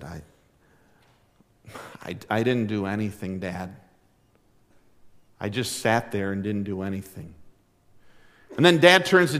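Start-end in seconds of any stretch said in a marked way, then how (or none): 0.0-7.3 quiet, lower, halting confession; sheepish. Retold or imitated. Son Billy admitting to his dad he did nothing, sheepish and soft. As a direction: mumble it like a guilty kid. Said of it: "Billy kind of sheepishly said".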